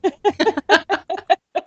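high bright burst of laughter. Real, not acted. Host laughing at her stalker motto joke. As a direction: laugh at your own joke.

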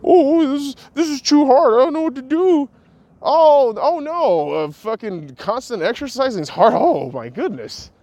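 high, loud whining mockery; whiny complainer. Mocking gym-avoiders, in a shrieking whiny voice. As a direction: ham up a whimpering quitter. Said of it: "The fucking nerve of some people".

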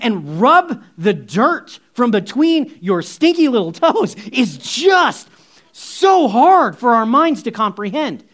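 usual speaking voice

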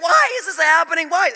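high, loud imitation of a frantic sister; mimicked panicked shout; retold yell. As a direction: cry it out as if speeding past. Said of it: "she's yelling as she's going by".

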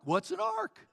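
high, baffled neighbor question; mock high-pitched puzzled neighbor; clueless neighbor. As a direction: play the dim neighbor who never heard of it.

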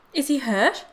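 high, loud, urgent question; mother's alarmed demand; anxious demand. A narrator's reading. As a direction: demand to know, fearful. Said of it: "demanded the mother".

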